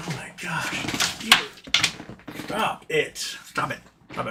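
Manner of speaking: hushed, breathy stop it to a pet; half-whispered telling-off of a furry pest; soft pet scolding. Real, not acted. To the pet knocking things over, hushed scolding.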